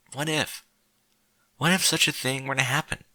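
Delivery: ordinary delivery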